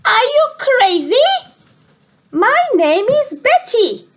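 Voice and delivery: high, loud indignant monster voice; furious monster. Acted. Monster snapping at George for calling her the Yeti, high and loud. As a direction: bellow it like an offended monster. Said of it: "with a furious gaze".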